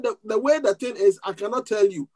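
normal talking voice

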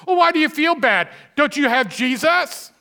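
high, loud, preachy mock question. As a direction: needle them like a smug churchgoer. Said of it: "They kinda Jesus juke you".

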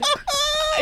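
loud, high-pitched burst of laughter; big laugh. Real, not acted. Laughing loudly at the down-under joke. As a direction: crack up completely.

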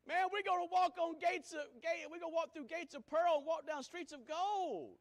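high, loud preaching climb; loud rising sermon; full-force preaching. Real, not acted. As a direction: lift the crowd toward heaven.

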